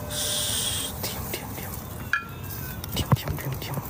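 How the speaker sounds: urgent hushed whisper. Real, not acted. Hushing friends in the haunted house, urgent whisper. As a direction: hiss them all to silence under your breath.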